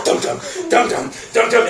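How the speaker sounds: pulsing drumbeat thuds by mouth; heightened tense soundtrack. Retold or imitated. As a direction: imitate suspense score beats. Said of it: "tension gets ratcheted up even more".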